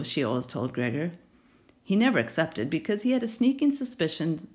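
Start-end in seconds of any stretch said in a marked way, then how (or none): none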